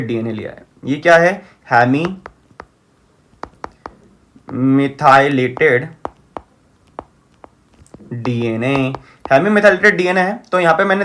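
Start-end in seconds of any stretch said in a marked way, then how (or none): 1.7-8.9 drawn out, word by word; dictating while writing. Real, not acted. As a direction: stretch each word with long gaps as you write. Saying the term while writing.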